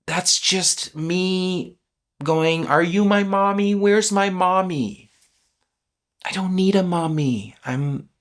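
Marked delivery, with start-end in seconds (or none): 2.7-5.1 whiny little-boy imitation; little wounded boy. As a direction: play a needy child calling for mother. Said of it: "as a little wounded boy going".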